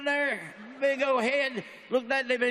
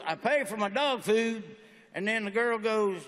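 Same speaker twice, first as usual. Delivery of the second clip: ordinary talk, nothing marked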